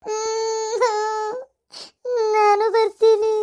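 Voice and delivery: slow, sobbing through the words; crying plea. Acted. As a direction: beg to come along while weeping. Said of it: "ಪಾಪ ಅಳಾಕತ್ತಿರೋದ್ ನೋಡಿದ್ರೆ". (ಅಳುತ್ತಾ ನಿಧಾನವಾಗಿ ಬೇಡಿಕೊಳ್ಳುವುದು)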